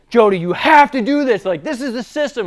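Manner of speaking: yelling him down to get through; yelling at employee. Retold or imitated. Boss yelling at employee Jody outside the shop, loud heated shout. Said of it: "we're in a yelling match".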